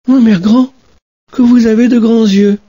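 put-on high, amazed little girl; wondering girl. Little girl in bed marvelling at grandmother, high. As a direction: marvel at her arms.

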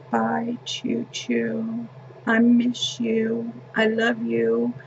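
put-on high, slow, drawn-out little-boy goodbye; imitating small grandson. Little boy telling departing grandma goodbye, slow child voice. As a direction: draw it out softly in a child's high voice. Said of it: "Nolan was like".

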